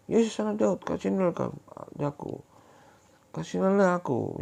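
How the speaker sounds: high, pleading call; pleading cry. Acted. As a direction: beg Jesus to notice you. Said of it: "Dia berteriak-teriak".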